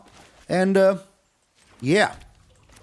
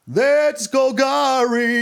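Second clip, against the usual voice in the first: slow emphatic catchphrase; slow drawn-out; 6 semitones higher, much slower than usual. Pretend. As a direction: stretch every word out slowly. Drawn-out catchphrase, first take.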